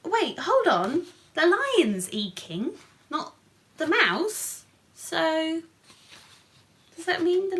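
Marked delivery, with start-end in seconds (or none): none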